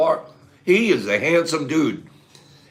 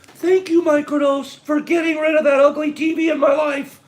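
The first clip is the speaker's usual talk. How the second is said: mock high voice; 12 semitones higher than usual. Shown as a demonstration. Showing chat how to thank Microdose, high mock voice. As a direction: squeak it out like a gushing fan. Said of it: "Watch this, watch this".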